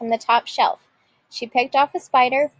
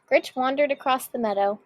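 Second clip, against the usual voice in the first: ordinary delivery, nothing marked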